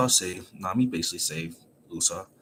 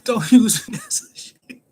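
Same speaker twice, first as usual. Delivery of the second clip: very high, louder panicked whine; Usopp protesting; 8 dB louder than usual. Retold or imitated. Retelling, Usopp protests at being used as a shield, very high and loud. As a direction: squeal it in protest. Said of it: "And Usopp is like".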